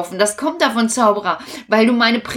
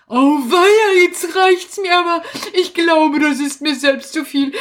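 panicked wizard; 7 semitones higher than usual. Acted. Wizard panics, flees crocodile.

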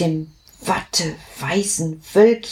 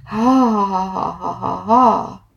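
a bright, bubbling run of laughs; gleeful laughter. Acted. A joyful drop laughs as it sinks away, bubbly laughter.

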